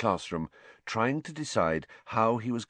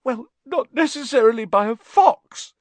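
loud, high indignant wail; upset, high-pitched outburst; tearful; 9 dB louder than usual. A narrator's reading. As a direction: protest through tears. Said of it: "looking up at Hermione with streaming eyes".

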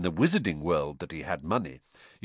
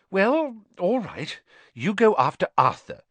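higher motherly voice giving in; higher relenting mum; Mrs Weasley, relenting; 11 semitones higher, 6 dB louder than usual. A narrator's reading. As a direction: give way with a fussy sigh.

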